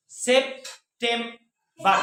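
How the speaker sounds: September pulled apart into sep, tem, bar; very slow, a pause after each syllable; three isolated beats. Shown as a demonstration. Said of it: "तीन बार मेरी जबान ने हरकत की".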